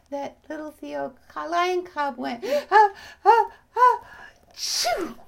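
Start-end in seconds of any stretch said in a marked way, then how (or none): 2.7-5.2 high, loud gasps building to a sneeze; big acted sneeze. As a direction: wind up and let out a mock sneeze. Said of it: "he gave a big sneeze".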